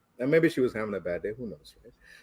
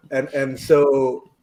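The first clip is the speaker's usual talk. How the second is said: normal talking voice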